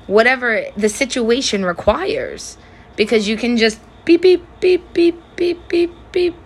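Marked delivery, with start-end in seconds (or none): 4.0-6.3 high, mechanical beep beep; robot beeps. Shown as a demonstration. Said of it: "That's me being a robot".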